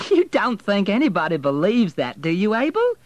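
high, loud, incredulous ridicule; mocking amusement. A narrator's reading. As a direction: scoff at the pretension, pitch way up. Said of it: "Melanie burst out laughing".

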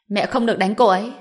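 ordinary delivery, nothing marked

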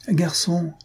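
called out. A narrator's reading. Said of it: "cria la vieille demoiselle".

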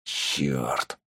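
low, very soft hissed curse; шипя. A narrator's reading. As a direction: hiss it under your breath. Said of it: "прошипел Тапок". (тихо, сквозь зубы)

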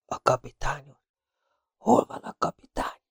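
parched whisper, high and soft; dry-throated whisper. A narrator's reading. Exhausted Felton asks for the captain, whispering. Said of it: "kiszáradt torokkal suttogta".

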